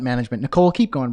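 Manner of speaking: ordinary delivery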